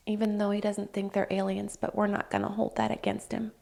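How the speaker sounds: quiet playful jab at Sam Fisher; teasing aside. Real, not acted.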